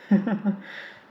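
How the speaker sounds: high, light laugh; laughing at the boy's remark, high and light; principal's laugh. Acted. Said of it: "the principal laughs".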